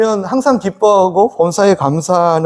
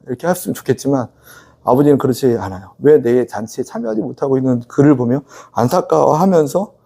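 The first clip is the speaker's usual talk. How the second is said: normal talking voice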